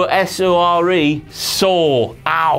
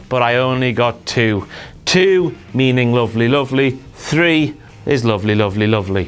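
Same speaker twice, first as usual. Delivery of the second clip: much lower, playful crooning; 9 semitones lower than usual. Acted. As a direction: croon it low and teasing. Playful lowered lovely riff.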